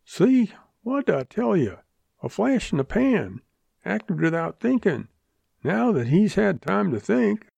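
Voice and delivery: high-pitched, scornful bystander; lightly acted harsh I-told-you-so; harsh jeer. A narrator's reading. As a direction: pitch it well up, jeering at him. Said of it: "Someone said harshly".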